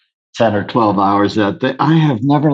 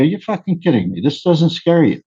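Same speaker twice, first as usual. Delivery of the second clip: mock disbelieving, pitched higher; half-acted annoyed outburst; retold irritation; 6 semitones higher than usual. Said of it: "I was getting pissed off".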